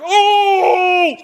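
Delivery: high, loud crowd "Oh!". Retold or imitated. Studio crowd reacting to a burn, loud shocked oh. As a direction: shout it like a stunned audience.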